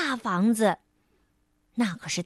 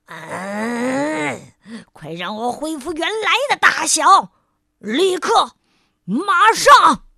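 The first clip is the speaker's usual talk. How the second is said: furious yell; 8 dB louder, slower than usual. Acted. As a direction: demand it, getting angrier to a shout. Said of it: "气得哇哇大叫". (气得哇哇大叫)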